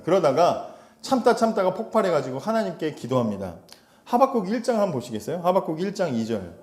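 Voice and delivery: ordinary talk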